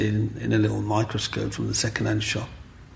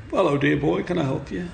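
shopkeeper greeting; 6 semitones higher than usual. Retold or imitated. Shopkeeper voiced in story.

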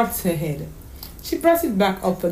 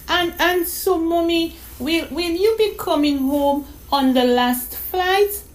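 put-on little girl's voice; 9 semitones higher than usual. Daughter missing her mother on the phone, wistful. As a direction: stammer like a sad small girl.

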